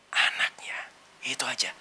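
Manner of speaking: hushed whisper to the congregation. Real, not acted. Preacher whispering that we are his children. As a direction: lean in and whisper it.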